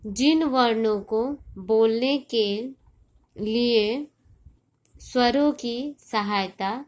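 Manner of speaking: slow and emphatic, pauses between phrases; slow dictation. Shown as a demonstration. Teacher defining consonants for children, slow and deliberate. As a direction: deliver it piece by piece for note-taking.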